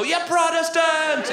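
high mock yell of a schoolkid, drawn out; jeering yell. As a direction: shout it up high like a kid taunting a neighbour. Kids jeering at neighbour's window.

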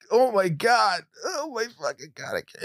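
the dam bursts mid-sentence; retold breaking down. Telling a friend the story, voice breaking into crying. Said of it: "And I was just a mess".

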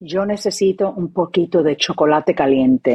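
low, deadpan Spanish request; very serious. Pretend. Asking the camera for hot chocolate, very serious. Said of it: "Be very serious".

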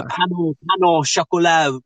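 "pain au chocolat" said with French vowels, nasal "pain"; French pronunciation. Real, not acted. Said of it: "you speak beautiful French".

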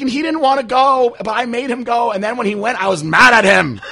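high, loud mock mom voice, laughing at the end; imitating mom. Mom telling son her story, high loud mock voice. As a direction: pitch up and wail like mom. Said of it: "And she was like".

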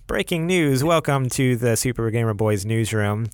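energetic mock newsroom opening; breaking news anchor. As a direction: announce it like a TV bulletin.

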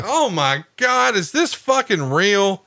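slow, high incredulous gasp of a question; high, slow mock amazement; mock disbelief. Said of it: "It's like".

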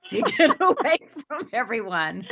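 words breaking up into laughter; real laughing. Real, not acted. Host teases guest about hiding in the closet, laughing. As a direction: laugh through the end of the sentence. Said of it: "I'm laughing because".

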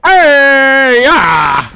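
loud, high wake-up yell; pipe major shout. Acted. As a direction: bark the band awake. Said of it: "let me get in my pipe major voice".

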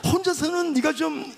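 high, gleeful announcement; mock excitement. Pastor teases newly married man about going, high mock excitement.

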